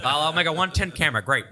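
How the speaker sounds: lightly voiced old-timey announcer; a hint of a vintage newsreel man. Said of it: "I'll give you about a B plus on your old timey voice".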